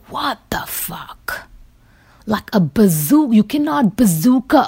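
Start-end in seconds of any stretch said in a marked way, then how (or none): 0.1-1.4 hushed, disbelieving whisper; stunned whisper. Retold or imitated. Reacting to bazooka-toting protesters, stunned whisper. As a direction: breathe it out in shocked disbelief.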